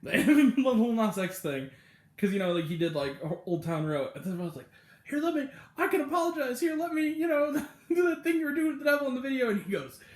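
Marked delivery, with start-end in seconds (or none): 5.1-9.5 raised pitch, quick, teasing eager voice; mock eager offer. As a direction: play the cheeky pal offering a stunt. [name] mock-offering to copy [name].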